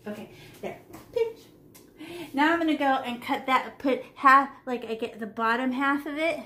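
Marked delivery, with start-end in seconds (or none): 0.1-1.4 soft unvoiced whisper. Real, not acted. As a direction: breathe it while concentrating. Hushed while painting.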